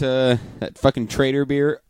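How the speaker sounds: ordinary delivery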